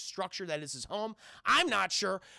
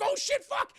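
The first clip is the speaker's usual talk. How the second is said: very high, loud mock panic; hammed-up jump-scare reaction; startled cursing; 5 dB louder than usual. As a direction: yelp the curses as if ambushed. Acting Kevin's fright at axe man.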